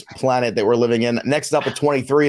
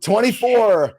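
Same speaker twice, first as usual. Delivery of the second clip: put-on Minnesota accent; 7 semitones higher than usual. Host announces the Vikings pick in a mock Minnesota accent. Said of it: "What accent was that?".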